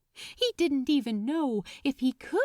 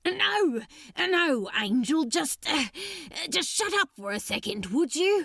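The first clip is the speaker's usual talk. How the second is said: don't let him interrupt, you're almost there; flustered outburst; 5 semitones higher than usual. A narrator's reading. Crowley cuts off angel, desperate.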